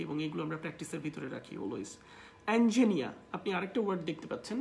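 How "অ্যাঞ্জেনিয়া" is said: "অ্যাঞ্জেনিয়া" as en-ji-NI-a; correct pronunciation. Shown as a demonstration. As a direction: open first vowel, no final r in "অ্যাঞ্জেনিয়া". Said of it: "this is called angenia".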